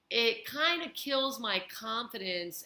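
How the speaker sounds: ordinary talk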